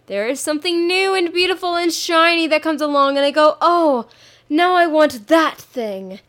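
high, bright-eyed gush over something shiny; breathless want for the new thing; excited gushing. Retold or imitated. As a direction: gush like a kid spotting a new toy.

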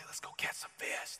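put-on hushed whisper to sleeping kids; dad whispering to wake his daughters. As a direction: breathe it so nobody else wakes. Waking little girls early to fish.

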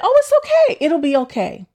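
high, airy brush-off; breezy reassurance. Retold or imitated. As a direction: play it off lightly, squeaky carefree. Dismissing friend's alarm.

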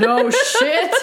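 high, laughing mock surprise; laughing sarcasm. Real, not acted. Reader hooting at another obvious item, sarcastic laughter. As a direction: exclaim it through a laugh.

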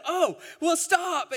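put-on high, loud child voice crying out from the back seat; shrill little kid yelling to stop the car; kids' excited shout. Little boys shouting at Mom to stop the car, high and loud.

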